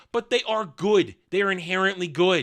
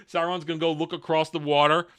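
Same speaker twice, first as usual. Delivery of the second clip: ordinary talk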